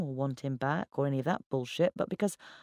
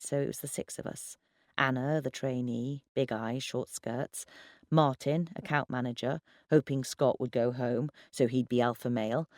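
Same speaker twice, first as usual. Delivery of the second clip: usual speaking voice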